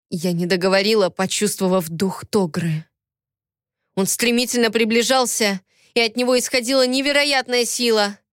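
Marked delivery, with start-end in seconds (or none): none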